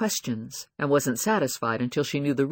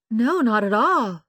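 slow, higher, louder shock; 9 semitones higher, 5 dB louder, slower than usual. A narrator's reading. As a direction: sound shocked, slowly. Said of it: "acting shocked".

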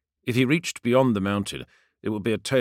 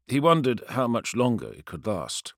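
plain reading voice

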